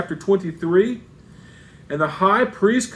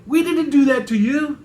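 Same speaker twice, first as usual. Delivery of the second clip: higher-pitched mock protest; sarcastic; 7 semitones higher than usual. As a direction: play sarcastic innocence, voice lifted. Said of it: "where Paul is sarcastic saying".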